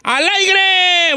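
high, loud radio host call; loud announcement. Acted. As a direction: announce it big to the listeners.

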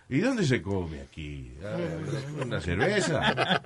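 slow, low mock-Argentine drawl; Argentine accent. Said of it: "si yo voy a Argentina".

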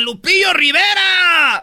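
high, slow, taunting shout; mock crowd heckle. Waiting fans taunt [name], drawn-out heckling shout. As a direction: holler the name like a heckler. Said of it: "Le gritaban".

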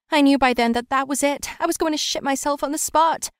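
plain reading voice

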